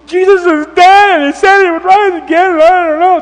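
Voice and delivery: hammed-up crying at high pitch; mock bawling. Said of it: "they're crying and they're bawling".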